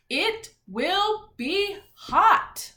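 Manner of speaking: IT WILL BE HOT, each word slow, loud and high; read with emphasis. Shown as a demonstration. Teacher reading an exclamation sentence to class, slow emphatic stress on every word through HOT. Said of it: "have to read it with emphasis".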